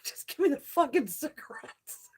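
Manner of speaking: high, breathy laughing demand. Real, not acted. Fed-up customer recalled by storyteller, laughing. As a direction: laugh right through a mock demand.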